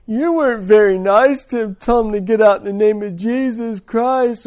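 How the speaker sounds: a hint of a prim girl's reproach; imitating the girl. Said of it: "the little girl said".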